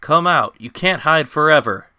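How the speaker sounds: loud, high challenge; shouting. Acted. Narrator challenges the hidden beast, shouting loudly. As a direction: yell it into the woods.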